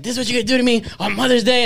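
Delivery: high fast mock scolding; imitating an angry mom, fast and high; mom's angry scolding. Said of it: "It's like".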